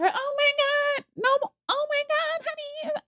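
high, drawn-out mock squeal; imitated gushing relative. Relative reacting to the proposal, imitated gushing. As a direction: gush in a very high, slowed voice.

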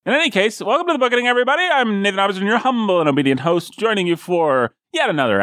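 high, bright announcer intro over music; podcast announcer. Acted. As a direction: sell the show like a radio host. Host reopens the show.

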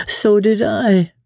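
slow, high wistful lady; sighing. A narrator's reading. Lady admits her disappointment in England, sighing and slow. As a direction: draw it out with a sigh. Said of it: "with a sigh".

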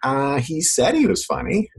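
high, slow, hesitant reply; mock-reluctant, noncommittal review; unconvinced concertgoer. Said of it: "And you're like".

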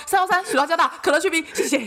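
a cowed customer reciting quickly; hurried customer order. Retold or imitated. Scared customer ordering fast.

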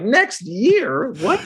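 high-pitched, disbelieving echo; incredulous retort. Retold or imitated. Reacting to the distillery tour pushed back, incredulous retort. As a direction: play up the disbelief, voice shooting up.

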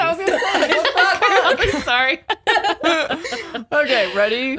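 giggling through the words; breaking up laughing while talking. Real, not acted. Cracks up mid-sentence.

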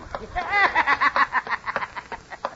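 high braying laugh; horse laugh. Acted. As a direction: bray out a tinny horse laugh. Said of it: "It sounded like a horse laughing into a tin can".

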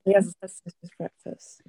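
unvoiced whisper to oneself; whispered practice. Real, not acted. Co-host rehearsing a slice of toast, whispered practice. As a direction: try the phrase out under your breath. Practising the accent phrase under breath.

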